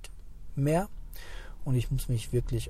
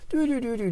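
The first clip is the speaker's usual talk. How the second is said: drifting-off hum; 10 semitones higher, faster than usual. Retold or imitated. Mind drifting off sound.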